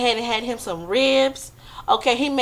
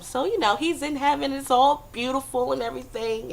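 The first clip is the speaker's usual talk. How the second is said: usual speaking voice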